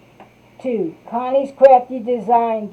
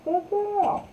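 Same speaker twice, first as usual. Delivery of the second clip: high praise to a pet; 10 semitones higher, 5 dB quieter than usual. Real, not acted. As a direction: praise the dog in a high little voice. Praising the dog.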